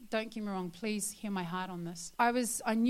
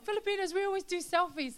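quoted boast; 10 semitones higher, 4 dB louder than usual. Retold or imitated. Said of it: "We're always told".

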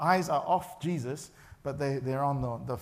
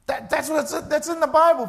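excited; 10 semitones higher, 9 dB louder than usual. Real, not acted.

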